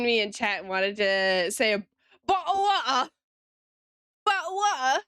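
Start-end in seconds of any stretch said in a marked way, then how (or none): 2.3-5.0 higher mock British accent, dropping the T's in bottle of water. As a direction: ham up a London accent. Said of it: "I'm British in it".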